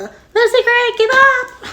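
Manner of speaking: high, loud hammed-up yell into the woods; mock yell. Snow hunting Lucy Gray in the woods, mock yelling. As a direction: holler after her like a crazed hunter.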